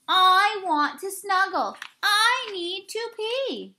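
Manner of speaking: high, pleading, slowing at the end; small critter wheedling; needy little guest. Acted. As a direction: whine for attention at bedtime. Guests pester Bruce at night.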